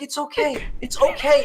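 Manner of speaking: high-pitched retold reassurance; mock anxious soothing. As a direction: imitate a mother trying to calm a screaming baby. Mother soothing her screaming baby.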